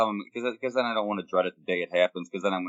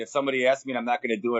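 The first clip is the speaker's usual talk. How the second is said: ordinary delivery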